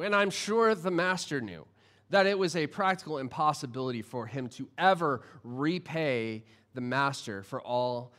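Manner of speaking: ordinary talk, nothing marked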